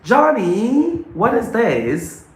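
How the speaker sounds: high, slow, excited classroom voice; cheerful kindergarten teacher; demo teacher to kids. Shown as a demonstration. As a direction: praise little pupils with big energy.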